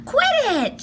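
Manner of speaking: high annoyed protest. Acted. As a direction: brush him off, high. Annie protests.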